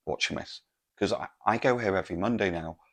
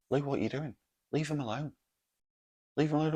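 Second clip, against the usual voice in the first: higher, slightly softer pleading protest; retold protest; 8 semitones higher, 3 dB quieter, faster than usual. Telling friend to leave the couple alone, protesting. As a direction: re-enact telling a friend off, pitched up.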